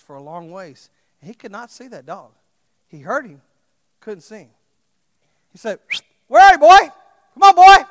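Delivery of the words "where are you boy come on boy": loud, high call across the pasture. Retold or imitated. Farmer calling his lost dog, loud call across the fields. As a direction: shout for the dog far away.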